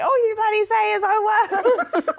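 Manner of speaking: high, fast mock scolding through laughter; laughing impression of a nagging mum; mum telling off. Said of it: "even my mum told me off".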